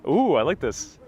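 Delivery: ordinary talk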